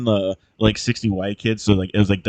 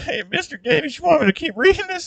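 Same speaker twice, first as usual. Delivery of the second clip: much higher, squeaky question to the teacher; mock flustered kid. Mocking a student asking his teacher, squeaky high. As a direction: squeak it out like an embarrassed teen.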